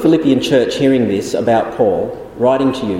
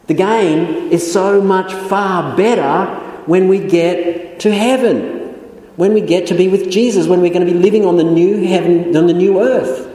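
fervent preaching; 5 semitones higher than usual. Acted. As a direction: proclaim heaven as the real gain. Preaching climax, heaven far better.

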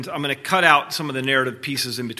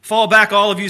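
louder, higher commanding call; 8 semitones higher, 11 dB louder than usual. A narrator's reading. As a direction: order them all back. Aslan commands the crowd.

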